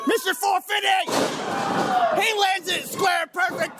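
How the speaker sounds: high excited yell; excited shout. Real, not acted. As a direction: scream the big moment. Calling finisher live.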